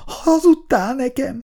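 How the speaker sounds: high, tearful hiccuping question; acted high-pitched sobbing accusation. A narrator's reading. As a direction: catch the breath as if after crying. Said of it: "hüppögte Icu".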